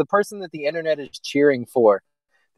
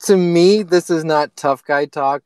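usual speaking voice

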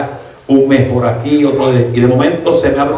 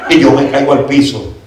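usual speaking voice

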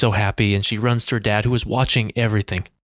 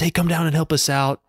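higher-pitched retold call to kids; 7 semitones higher than usual. Calling kids down from bleachers.

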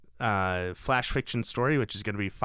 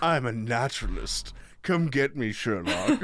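taunting villain. Pretend. Joking as the naturalist villain.

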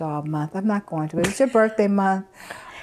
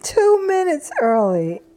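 sassy scolding; 10 semitones higher, 5 dB louder, slower than usual. Acted. Scolding friend over two minutes.

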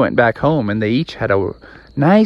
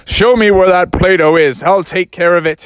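louder, raised-pitch fighting dad; take-charge father; 5 semitones higher, 4 dB louder than usual. Acted. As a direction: charge in ready for battle.

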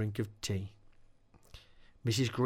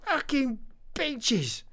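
furious scream; 13 semitones higher, slower than usual. Acted. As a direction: scream it, leaning on fucking. Dave tied up, cursing the witches.

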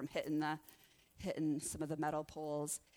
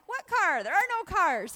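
incredulous; 12 semitones higher, 9 dB louder than usual. Retold or imitated. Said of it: "And I'm like".

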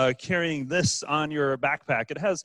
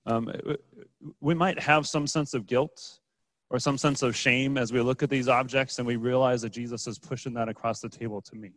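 normal talking voice

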